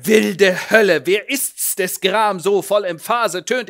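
loud, grand Shakespearean outrage; a ham actor declaiming against a crying baby; theatrical rant. Acted. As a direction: rant like a tragedian in the wings. Actor ranting in Shakespeare words.

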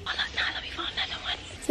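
soft unvoiced whisper, careful. Real, not acted. Mother showing her daughter a deer, in a whisper. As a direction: hush it so the deer stays.

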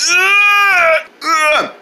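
high, drawn-out effort groan; straining grunt. Pretend. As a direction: strain against a heavy load. Man struggles to lift heavy hand.

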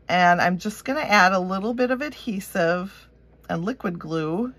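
usual speaking voice

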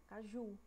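Caju stressed on JU; stress on last syllable. Shown as a demonstration. Teacher repeating the correct word, Caju stressed on JU. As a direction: lengthen the final JU of Caju. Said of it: "Ju tá puxando mais".

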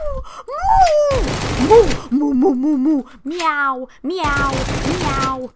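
playful animal noises. Pretend. Gamer mooing and meowing to taunt the bots. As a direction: silly moo and meow calls.

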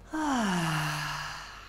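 long audible sigh of relief; cleansing sigh. Shown as a demonstration. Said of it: "do one cleansing breath".

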